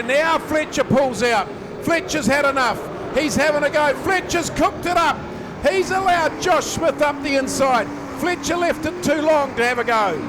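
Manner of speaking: rising excited race call; motorsport commentator. Real, not acted. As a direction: call the action as it heats up. Live race call building up.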